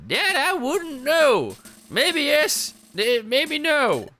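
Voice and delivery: a hint of a wise old man. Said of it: "I'll do an old man voice".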